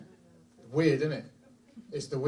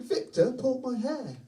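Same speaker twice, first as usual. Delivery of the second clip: put-on crying child; 10 semitones higher than usual. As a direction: repeat it as an upset child, without shouting. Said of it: "He's crying".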